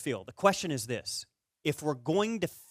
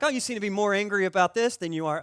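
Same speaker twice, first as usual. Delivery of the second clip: higher, louder teasing jab; needling; 6 semitones higher, 5 dB louder than usual. Retold or imitated. Buddy poking at the angry golfer, retold needling. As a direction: poke at your friend, imitating his teasing. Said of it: "He's poking at me".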